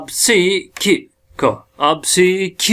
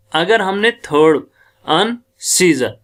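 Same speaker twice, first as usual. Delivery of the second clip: ordinary delivery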